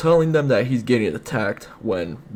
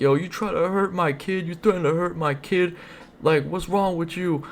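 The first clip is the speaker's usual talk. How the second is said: imitating an irate dad in a raised pitch; mock upset screaming; 9 semitones higher, 4 dB quieter than usual. Dad confronting the man who hurt his kid, mock screaming. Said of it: "My dad starts screaming at him".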